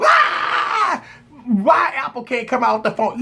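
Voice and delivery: high mock cry of panic; mock uproar. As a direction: play the impatient crowd. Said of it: "everybody in the uproar".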